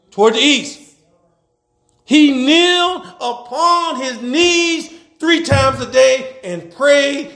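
slow, drawn-out verse declamation; preaching scripture. Acted.

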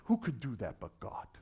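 low, soft rhetorical question; hushed awe. Real, not acted. Preacher marvelling at what God does, hushed and low. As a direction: ask it low, falling away on God.

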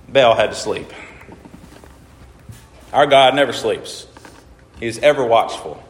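ordinary talk

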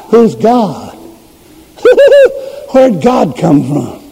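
high scornful cackle, then sneering questions; mocking laugh. A scoffer laughing at believers talking about God, mocking. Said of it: "you laugh".

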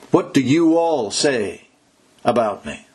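slow down and lean on "you"; slow stress on YOU. Shown as a demonstration. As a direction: read Jesus's question slowly with weight on "you". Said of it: "It's also plural".

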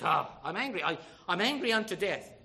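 loud, hammed-up angry protest; Jonah's anger. Jonah answers God's talk of mercy, acted loud anger. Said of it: "He gets angry".

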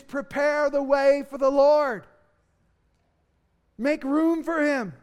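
loud, slow proclamation of the way for the Lord; announcing the Lord's coming; herald's cry. Acted. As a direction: cry it out like a herald ahead of a king. The cried-out message voiced.

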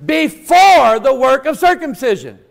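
high, loud, emphatic sermon delivery; full-force preaching. Real, not acted. Preacher telling the congregation God will bless them, impassioned preaching. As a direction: preach it out with power to the whole room.

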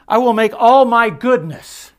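high, loud, ringing proclamation; booming voice of God. Acted. Preacher voicing God to Moses, booming and high. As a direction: thunder it out as God speaking.

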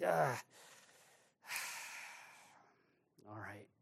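sighing, breathy give-in; resigned sigh. Retold or imitated. Pastor giving in to God's correction with a sigh. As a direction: let the air out and surrender.

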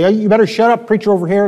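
retold scolding. Manager telling swearing staff to shut up, retold scolding.

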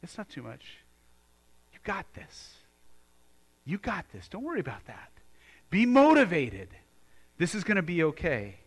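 a coaxing reassurance; a gently encouraging word of reassurance; reassuring voice. Acted. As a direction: comfort them like a coach. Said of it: "And he says".

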